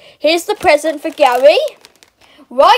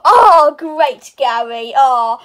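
normal talking voice

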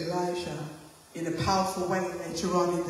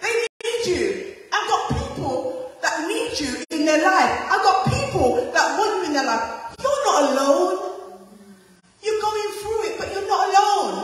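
God encouraging Elijah; 9 semitones higher, 4 dB louder than usual. Acted. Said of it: "he said".

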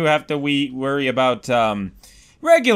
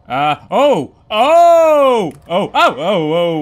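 loud, high oh of shock; startled cries; 5 semitones higher, 8 dB louder, slower than usual. Real, not acted. Player startled as the creature grabs him. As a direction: cry out as you get grabbed.

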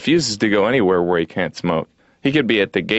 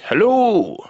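high, sing-song small-town welcome; friendly local guy; 10 semitones higher than usual. Retold or imitated. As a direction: greet like an overly friendly North Dakotan. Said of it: "who'd be like".

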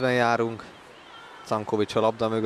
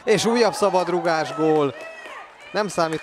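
excited goal call; 7 semitones higher than usual. Real, not acted. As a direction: call the free-kick goal. Free kick goal. (izgatott gólkiáltás)

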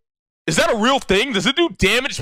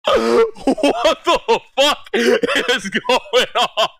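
ordinary talk, nothing marked

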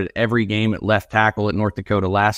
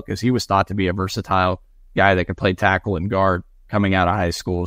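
usual speaking voice

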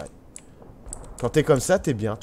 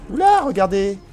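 surprised; 7 semitones higher, 7 dB louder than usual. Real, not acted. Spotting something in game.

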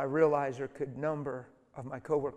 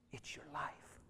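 whispered emphasis; 14 dB quieter, slower than usual. Real, not acted. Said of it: "hushed emphasis".

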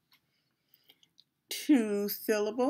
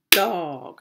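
"Dog" as one long, louder beat; one-beat syllable demo; 6 dB louder than usual. Shown as a demonstration. Teacher confirming the clap count, one firm beat. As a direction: land "Dog" firmly in a single drawn-out syllable.

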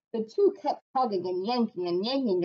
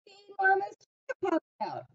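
excited reveal; 9 semitones higher, much faster than usual. A narrator's reading. Climax of the story.

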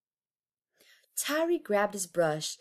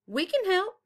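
high, bright call across the street; 14 semitones higher, 3 dB louder than usual. Acted. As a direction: call out an offer of help. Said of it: "neighbors called".

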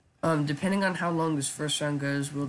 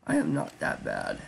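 voice crack. Real, not acted. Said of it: "I just voice cracked him".

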